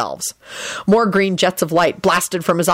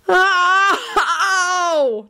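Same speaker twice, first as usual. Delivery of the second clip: high, drawn-out yelp of pain; pained yell; 12 semitones higher than usual. A narrator's reading. Neville is struck in the forehead, yelling. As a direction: howl as if hit. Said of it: "Neville yelled".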